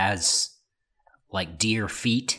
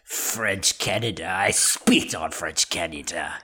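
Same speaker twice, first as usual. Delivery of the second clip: scornful put-on French accent; 3 semitones lower than usual. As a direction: spit the words out in a mock French accent. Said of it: "dumbest accent I've ever done".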